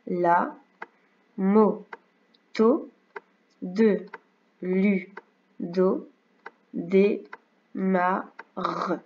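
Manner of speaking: each syllable on its own beat with gaps; split into syllables. Shown as a demonstration. Teacher models syllable reading for a young pupil, slow and careful. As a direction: read "la, moto, de, lu, do, des, ma, re" one syllable at a time. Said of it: "on va faire les syllabes".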